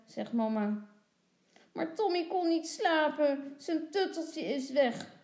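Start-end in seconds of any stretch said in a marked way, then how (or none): 1.7-5.2 put-on higher-pitched, pleading child; little girl's protest. A narrator's reading. Lotje explains to her mother, protesting. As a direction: plead your case like a small girl. Girl defends herself to parents.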